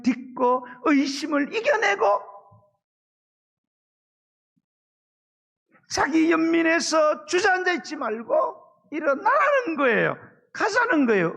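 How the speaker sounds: high, loud, tearful pleading; tearful preaching shout. Acted. Pastor urging the downcast flock to rise, in a high tearful shout. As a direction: cry it out to the back row, voice near breaking. Preacher urges at full force.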